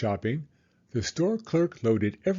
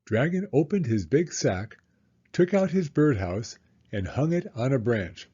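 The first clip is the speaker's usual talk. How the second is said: usual speaking voice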